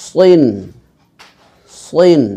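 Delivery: সইন with a full, heavy s; heavy emphatic s in সইন; thick heavy S. Shown as a demonstration. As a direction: make the s of সইন thick, drawn out. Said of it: "মোটা করে উচ্চারণ করতে হবে".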